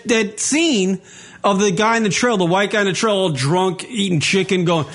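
ordinary delivery

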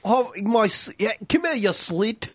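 high, halting mock English; English accent. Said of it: "try to do an English accent".

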